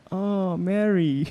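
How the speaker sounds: high, drawn-out bystander exclamation; imitated onlooker. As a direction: mimic a voice from the crowd, slow and high. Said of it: "you just hear in the background".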